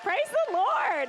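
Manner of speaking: high, loud, slow exultant praise; joyful praise. Real, not acted. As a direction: lift it up in real joy. Joyful praise over coming surgery.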